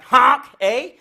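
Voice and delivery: loud goose honk with a Canadian eh. Retold or imitated. Canadian goose honking at the crowd, loud and nasal. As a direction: honk it like a goose, then add eh. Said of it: "Canadian geese, they should be like".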